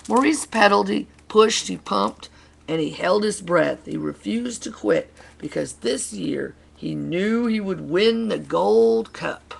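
emphatic, loud picture-book narration; animated storyteller urging the hero on; dramatic story reading. Acted. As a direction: read it with effort and drive, punching each action. Reads picture book to children.

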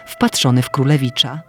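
plain reading voice

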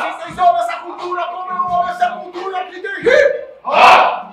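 rally the crowd; rally call. Real, not acted. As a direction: rally call ending in a high yell. Host repeats rally.

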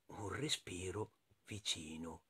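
very soft, low, slow whisper-like narration; hushed suspense. A narrator's reading. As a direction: drop to a slow, secretive hush. Suspense, breath heard close by. (sussurro lento di suspense)

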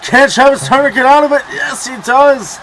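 high, loud, hyped call; excited commentary. Real, not acted. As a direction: call the hold at full volume. Arm bar locked in.

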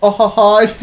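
a triumphant little cackle and laugh; gleeful cackle. Real, not acted. Streamer cackling after his play worked. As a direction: crow with delight at the kill.

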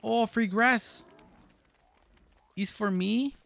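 high, slow delighted cry; drawn-out delight, high; gleeful. Acted. Gleeful over free grass.

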